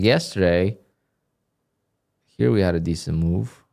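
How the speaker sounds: ordinary talk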